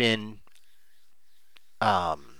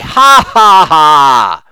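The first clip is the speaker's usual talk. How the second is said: loud scornful ha ha ha, higher; mocking laugh; 3 semitones higher, 11 dB louder, much slower than usual. Investor scoffing at warnings with a loud mocking laugh.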